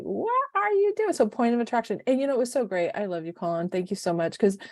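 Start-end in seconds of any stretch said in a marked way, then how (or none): 0.0-1.1 self-scolding. Retold or imitated. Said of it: "and I'm just like".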